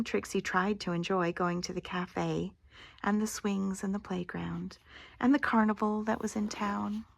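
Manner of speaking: usual speaking voice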